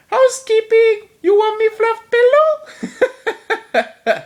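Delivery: loud, very high falsetto maid; housekeeper movie quote. Acted. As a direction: squeal it in a hammed-up falsetto. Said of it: "so many great one-liners in this movie".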